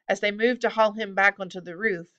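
usual speaking voice

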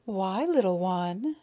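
slow, mildly condescending coo; drawn-out, patronizing question; condescending interest. A narrator's reading. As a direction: feign interest in a small child. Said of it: "putting on an interested air".

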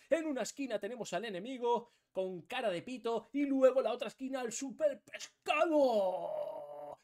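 loud ring-announcer hype; over-the-top fight announcer; boxing announcer. Pretend.

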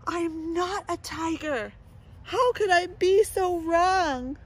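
whimpering dismay, slow; hammed-up tearful wail; crying over being wrong; mock crying. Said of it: "crocodile realizes it is wrong".

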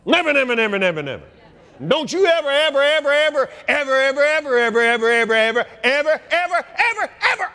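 never and ever pounded over and over; hammering repetition. Real, not acted. The preacher warns the church, pounding each word. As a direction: drum the words in.

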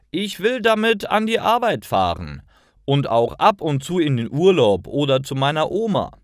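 put-on young man keen on his first car; eager young Martin. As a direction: rattle off your plans, eager to get going. Narrator voices Martin's eager answer.

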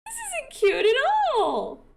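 high, loud cries of dismay; horrified outcry. Real, not acted. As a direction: wail in drawn-out horror. Horrified reaction to something big in game.

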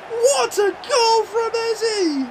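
high, slow, breathless awe; awed slow. Real, not acted. Fan still shaken by the goal, awed and slow. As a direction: savour every word. After the winner.

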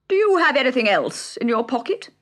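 high, loud bird voice; a big, bold Dodo question. A narrator's reading. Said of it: "asked the Dodo".

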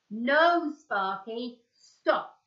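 loud warning cry. A narrator's reading. George warning Sparky away from the pole, a loud cry. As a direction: stop him before he jumps. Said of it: "cried George".

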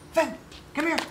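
calling the dog. Real, not acted.

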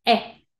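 brief "E" as eh; short E sound. Shown as a demonstration. Teacher naming the short sound, brief "E". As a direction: cut "E" short, a quick eh. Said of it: "Sonido corto".

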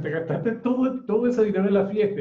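retold astonished question, pitched well up; muy sorprendidas. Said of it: "muy sorprendidas, le dicen". (sorprendidas, con voz más aguda e incrédula)

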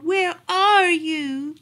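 slow, high, loud wail; plaintive cry. A narrator's reading. Lost dog calling for the lady, crying plaintively. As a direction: stretch it into a long lonely call. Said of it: "heard his plaintive cry".

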